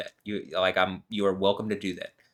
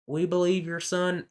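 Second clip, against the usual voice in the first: higher, sing-song mock preacher; 10 semitones higher than usual. Imagining Joel Osteen telling his mom, high mock preacher voice. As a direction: squeak it out like a fake pastor pleading. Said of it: "I can't do his accent".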